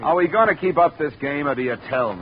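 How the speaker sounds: huffy. Acted. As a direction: snap at him, fed up with the runaround. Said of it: "Don't get huffy with Stroganoff".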